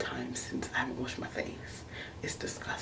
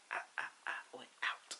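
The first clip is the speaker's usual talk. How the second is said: whispered; 8 dB quieter than usual. Retold or imitated. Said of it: "I was like".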